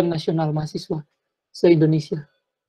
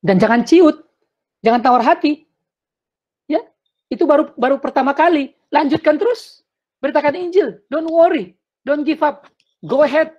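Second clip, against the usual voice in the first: rousing encouragement; 8 semitones higher, 4 dB louder than usual. Real, not acted. Preacher rallying young evangelists.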